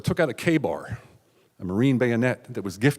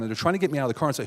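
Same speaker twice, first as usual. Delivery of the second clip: ordinary delivery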